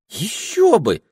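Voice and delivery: high eager tiny voice; ringing tiny voice. Acted. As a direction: chirp it eagerly. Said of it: "отозвался звенящий голосок".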